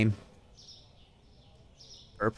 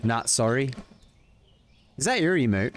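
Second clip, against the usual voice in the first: ordinary delivery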